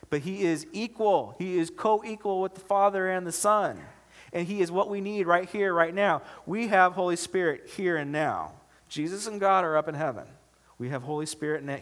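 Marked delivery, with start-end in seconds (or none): 0.1-10.3 raised, insistent pulpit voice; emphatic preaching. Real, not acted. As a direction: drive the point home to the congregation, high and punchy. Preaching at full force.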